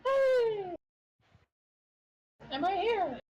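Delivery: high squeaky fairy voice; a tiny pixie calling out. Acted. Pixie character popping up.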